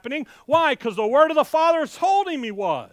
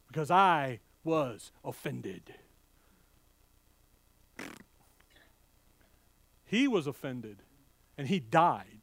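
hushed solemn; 11 semitones lower, 7 dB quieter than usual. Real, not acted. Preacher turning to Christ's death, hushed and solemn. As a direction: drop to a reverent hush.

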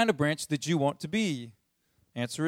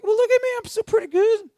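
much higher, mock self-satisfied voice; smug self-talk; 6 dB louder than usual. Preacher mocking churchgoers who fool themselves, smug and high-pitched. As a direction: puff yourself up, fooling yourself. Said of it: "We're like".

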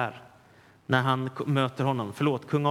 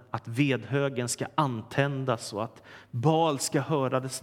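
ordinary delivery

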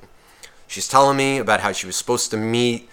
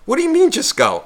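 much higher mock girl, hand on his shoulder; exaggerated girl voice. Teller mimicking the girl touching his shoulder in a much higher voice. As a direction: push the pitch way up, pleading. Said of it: "put her hand on my shoulder and she goes".

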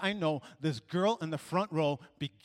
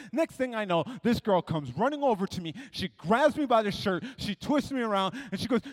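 ordinary talk

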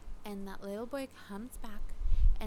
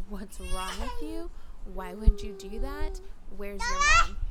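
put-on rising to a loud, high yell at a kid; loud scolding; 11 semitones higher, 6 dB louder than usual. Mother confronting the boy who spat on her baby, loud scolding. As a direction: scold him loudly, pitch climbing higher. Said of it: "but I did loudly s-, say".

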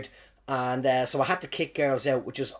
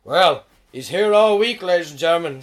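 put-on drunk boss; 7 dB louder than usual. Drunk boss stumbling back to introduce the act, mock slurred. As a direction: bellow a sloppy announcement, imitating a drunk host. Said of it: "the boss stumbles back in again and goes".

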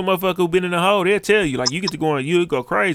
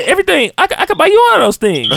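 mock cartoon voice; 10 semitones higher than usual. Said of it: "and he said".

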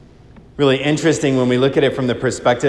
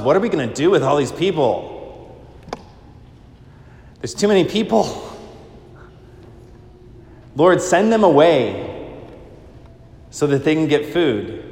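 higher, fast, fretting voice; anxious disciples; 4 semitones higher than usual. Retold or imitated. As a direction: panic a little about the crowd. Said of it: "they thought to themselves".

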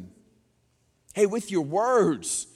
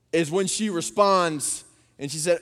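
normal talking voice

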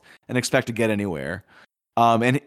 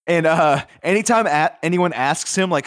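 ordinary talk, nothing marked

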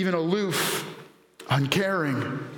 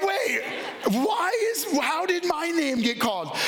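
high, laughing mock protest; laughing disbelief; 9 semitones higher than usual. Dad woken at night, high laughing protest. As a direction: squeak it out in amused disbelief. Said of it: "And I'm like".

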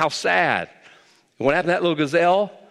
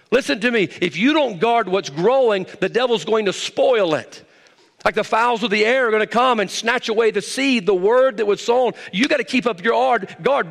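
raised, ringing preacher's exhortation; preaching; 7 semitones higher than usual. Acted. Pastor warns the church to guard their growth, fervent preaching. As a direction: drive it home to the congregation.

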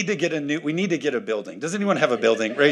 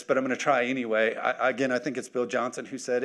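ordinary talk

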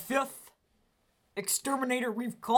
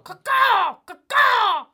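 loud crow caw; 13 semitones higher, 12 dB louder, much slower than usual. Retold or imitated. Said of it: "birds out in your tree".

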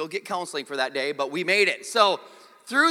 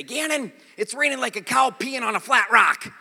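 loud, high-pitched mentor impression; folksy mentor; 7 semitones higher, 7 dB louder than usual. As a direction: holler it like an old saying from your mentor. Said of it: "I had a mentor, w-, and he used to say".

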